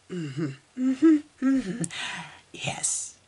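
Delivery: strained whispered mm-hmms. Real, not acted. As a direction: breathe agreement, choked up. Choked, whispered agreement.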